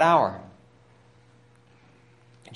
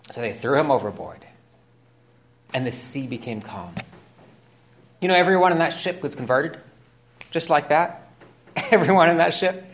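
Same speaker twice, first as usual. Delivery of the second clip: ordinary delivery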